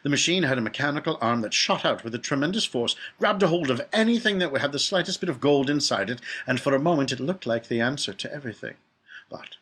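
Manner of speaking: ordinary delivery, nothing marked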